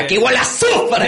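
high, loud mock Chávez with a laugh; Chávez impression. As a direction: ham up Chávez's famous line. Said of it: "¿Quién es Chávez". (imitación exagerada de Chávez, aguda y fuerte)

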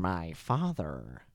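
slow, low, soft movie villain; hushed villain monologue voice; Joker impression. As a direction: drag it out quietly and creepily. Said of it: "Heath Ledger did a really fucking good job".

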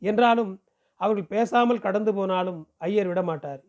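ordinary delivery, nothing marked

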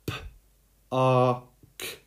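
P-A-R-K split into separate sounds; sounded out letter by letter. Shown as a demonstration. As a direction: sound out P-A-R-K for children. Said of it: "break it up".

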